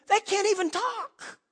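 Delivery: high-pitched exasperated squeal; incredulous. Real, not acted. Preacher describes grandsons who can't converse, high incredulous voice. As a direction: let the pitch jump up in disbelief.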